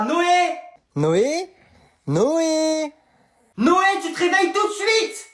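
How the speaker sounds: high, insistent call to a dozing pupil; teacher calling out. Acted. Teacher waking Noé in class, insistent high call. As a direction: rouse him sharply, pitch climbing.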